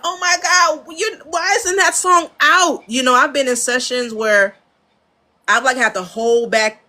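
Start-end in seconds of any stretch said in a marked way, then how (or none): 0.1-2.8 high, excited gush; amazed delight. Retold or imitated. Engineer hearing unreleased music, high excited gush. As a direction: gush over a song that blew you away. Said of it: "And I'm like".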